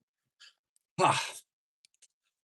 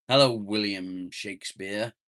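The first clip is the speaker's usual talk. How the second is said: normal talking voice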